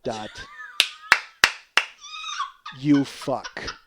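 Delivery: ordinary talk, nothing marked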